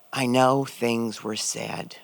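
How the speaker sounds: slowly, a quiet admission; slow, halting brother. Retold or imitated. Dying brother, slowly hinting at an apology. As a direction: let each word drag out of him. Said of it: "he looks at me and he says".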